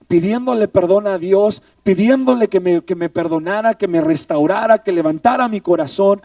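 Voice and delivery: fervent retelling at full volume; impassioned preaching. Retold or imitated. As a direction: preach it out forcefully, phrase piling on phrase. Preacher recounting his tearful plea.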